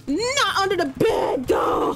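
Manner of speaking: slow, pleading shout; panicked yell. Real, not acted. Gamer yelling as he crawls the wrong way.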